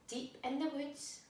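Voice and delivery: plain reading voice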